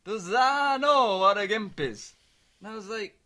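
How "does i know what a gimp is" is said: slow, louder imitation of dad asking; dad's voice. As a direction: draw it out like a baffled father. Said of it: "that's what he kept saying".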